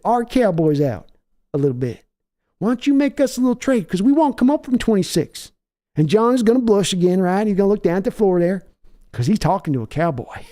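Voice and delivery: a swaggering team owner selling a deal over the phone; hammed-up cocky billionaire owner working the phone; Jerry Jones impression. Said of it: "[name], may I ask what Jer-, how Jerry feels?".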